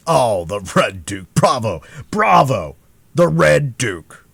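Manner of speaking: loud, slow delighted cheer; delighted cry. A narrator's reading. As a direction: crow with glee. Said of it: "cried Porthos".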